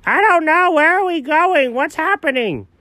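high-pitched panicked babble; mock panic. Dog asked what he is thinking, high comic panic. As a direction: rattle it out in a high, comic fluster. Panicked reply to friend's question.

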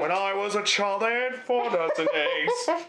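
slow sung Gaston line, lightly voiced; Gaston song. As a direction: croon it like Gaston, half joking. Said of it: "that song".

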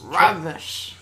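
"Rubbish" said with relish; savoured word. Shown as a demonstration. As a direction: roll out "Rubbish" to test how satisfying it feels. Trying both words for satisfaction.

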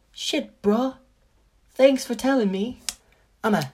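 high, mock-thankful bro voice; mock grateful friend. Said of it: "then I'm gonna be like".

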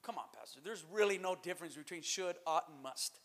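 low, very soft imagined objector; mock objection. Doubting church member to the pastor, quietly objecting. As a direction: grumble it under the breath. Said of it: "You might say".

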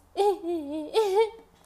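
high loud laughing burst; loud burst of laughter. Real, not acted. Laughing at herself crying.

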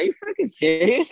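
retold indignation, pitch leaping high; mad disbelief. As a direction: act out an outraged challenge without shouting. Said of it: "made me really mad".

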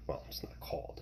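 quick whispered self-correction under the breath; whispered aside. Real, not acted. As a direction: drop to a whisper to correct yourself.

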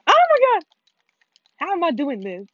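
very high, loud outburst; exasperated shriek. Real, not acted. Player fed up with random buttons, shrieking in exasperation. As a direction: shriek it in real frustration.

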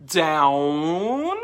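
DOWN drawn out and rising at the end; long, upswept DOWN; questioning lift. Shown as a demonstration. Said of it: "What do a lot of people do?".

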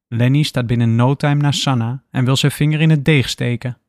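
usual speaking voice

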